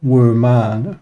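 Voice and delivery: "woman" stretched out as WOO-MAN; mispronunciation. Shown as a demonstration. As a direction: say "woman" slowly, full "man" at the end. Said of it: "not woman".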